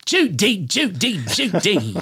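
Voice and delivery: put-on high, clipped-free old-movie Cary Grant voice; cheesy retro celebrity impression; hacky Cary Grant. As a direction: do a deliberately bad Cary Grant. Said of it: "that really hacky Cary Grant impression".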